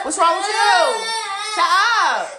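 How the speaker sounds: loud, high scolding yell; angry shout. Real, not acted. Mother scolding a crying child, angry shouting. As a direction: demand an answer, full volume. Mother yelling at fighting kids.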